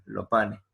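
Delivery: "Lo pane" with both final s sounds left off; dropped final s. Shown as a demonstration. Teacher repeating the casual "Lo pane" to the class. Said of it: "en vez de decir los panes".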